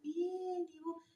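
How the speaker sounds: slow, soft, dragging greeting; lazy slow talk. Shown as a demonstration. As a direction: drawl it out like you can't be bothered. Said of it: "la gente que habla lento".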